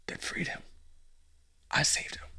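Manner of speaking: soft, hushed reveal; a whispered I saved him; whispered confession. Pretend. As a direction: breathe the secret out.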